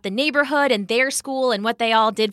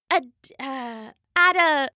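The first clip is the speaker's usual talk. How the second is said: unsure attempt; 6 semitones higher than usual. A narrator's reading. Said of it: "She made a sorry face".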